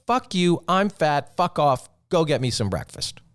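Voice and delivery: imitating her tearful outburst; mock angry girlfriend. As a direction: boyfriend imitating his crying girlfriend lashing out. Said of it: "And she said".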